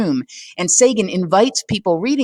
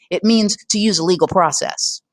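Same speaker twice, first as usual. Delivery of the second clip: ordinary delivery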